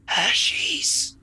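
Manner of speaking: whispered, dark reveal; dramatic whisper. Acted. Villain names his traitor ally, whispered reveal. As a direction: breathe the name like a shocking secret.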